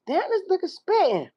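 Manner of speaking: high-pitched hyped exclamation; impressed reaction. Retold or imitated. Reacting to a rapper on a record, high hyped exclamation. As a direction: blurt it out high, as if floored by a rapper.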